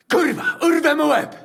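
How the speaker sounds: furious shouted threat; angry shout. Acted. Angry mercenary threatens Chaba.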